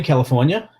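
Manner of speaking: usual speaking voice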